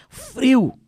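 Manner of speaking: FRIO lançado alto, agudo e caindo no fim; exclamação enfática. Real, not acted. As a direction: exclame "Frio" com força e queda no tom. Exclama o frio que fazia. ("Frio" exclamado, com ênfase forte)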